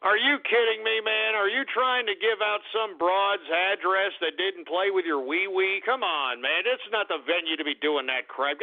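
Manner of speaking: ordinary talk, nothing marked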